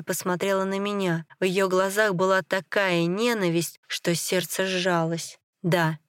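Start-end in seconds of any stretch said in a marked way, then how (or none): none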